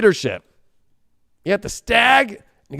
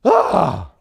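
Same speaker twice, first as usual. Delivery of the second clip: usual speaking voice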